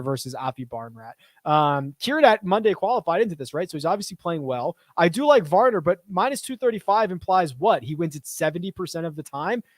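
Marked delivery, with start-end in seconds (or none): none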